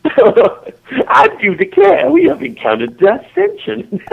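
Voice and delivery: high, slow mock Southern belle; Southern patois. Two friends reacting to a scolding, mock Southern voice. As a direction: declare it with hammed-up Southern gentility. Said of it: "of our southern patois".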